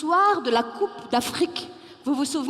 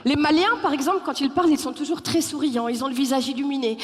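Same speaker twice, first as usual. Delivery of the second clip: ordinary talk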